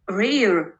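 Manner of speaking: ordinary delivery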